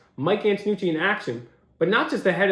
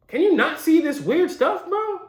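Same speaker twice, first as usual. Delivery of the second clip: pitched high in disbelief; incredulous; 10 semitones higher than usual. Real, not acted. Host asking how nobody sees weird stuff, incredulous. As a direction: plead it upward, unable to believe it.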